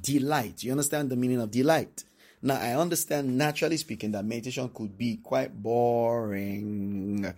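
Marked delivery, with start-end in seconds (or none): 4.1-7.3 slow, drawn-out bored drone; mock boredom. Preacher admitting meditation can feel dull, mock-bored. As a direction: stretch it out as if bored stiff. Acts out how boring meditation feels.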